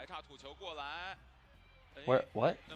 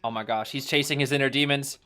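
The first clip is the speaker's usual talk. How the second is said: ordinary talk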